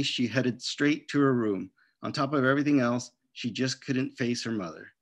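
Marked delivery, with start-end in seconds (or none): none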